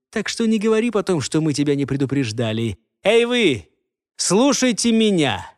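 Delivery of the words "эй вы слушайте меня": high call to the men. Acted. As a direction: call out to the guards. Nomad calls to his men.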